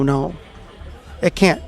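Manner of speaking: ordinary talk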